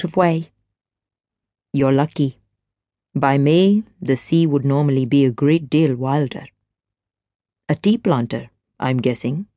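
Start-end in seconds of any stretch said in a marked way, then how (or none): 1.7-6.5 lower, easy man's voice; smooth stranger. A narrator's reading. Stranger chats to Gwen at the rail, low and easy. 7.7-9.5 lower, easy man's voice; smooth stranger. A narrator's reading. Stranger chats to Gwen at the rail, low and easy.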